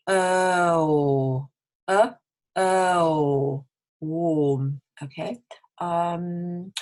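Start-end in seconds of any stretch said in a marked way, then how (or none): 0.1-4.8 "uh" sliding into "oh" as lips close; loud, held "oh", then a long "warm"; gliding vowel drill. Shown as a demonstration. Teacher builds up to warm, stretching each vowel. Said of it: "then you can close your lips".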